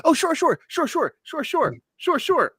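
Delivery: high-pitched rapid-fire yielding squeak; mock eager compliance. Mocking Chili giving in to the officer, mock eager compliance. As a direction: parody a pushover caving instantly. Mocks a man complying with police.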